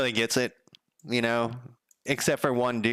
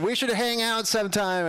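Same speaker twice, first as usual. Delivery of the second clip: eager invitation; 12 semitones higher than usual. Retold or imitated. Delivery guy eager to befriend.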